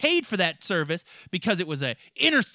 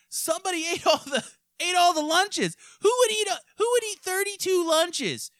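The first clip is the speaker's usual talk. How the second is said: high mock whine; 14 semitones higher than usual. Retelling a comedy sketch, high voice.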